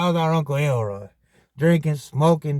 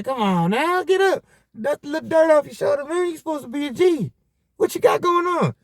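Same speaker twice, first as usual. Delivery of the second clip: higher, fast teasing "you supposed to be a G"; high mocking chiding; 9 semitones higher than usual. Nephew mocking Uncle Elroy for crawling, high and teasing. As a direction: roast the uncle for hiding.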